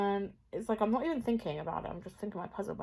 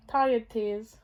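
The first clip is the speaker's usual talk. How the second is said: laughing; 2 semitones higher, 7 dB louder than usual. Real, not acted. Laughing at tired tears.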